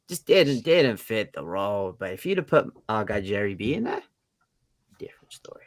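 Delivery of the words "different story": 1 whisper. Real, not acted. Aside about Jerry B.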